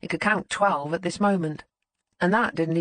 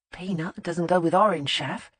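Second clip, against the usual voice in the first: ordinary delivery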